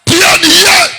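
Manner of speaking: high, shouted stern command; wrathful prophet. Acted. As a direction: bark it like a furious prophet. Preacher rebukes the congregation.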